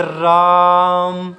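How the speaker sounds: राम with रा held long and slow; drawn-out Ram. Shown as a demonstration. Teacher demonstrating a stretched vowel, slow राम. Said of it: "रा को खींच रहे हैं".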